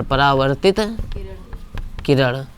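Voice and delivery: teacher dictating a term to copy; slow dictation. Shown as a demonstration. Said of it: "dictating term for notes".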